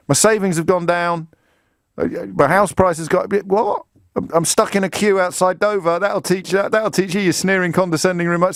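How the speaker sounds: lightly acted, high-pitched defiant caller; mock gloating caller. Acts out gloating caller's losses.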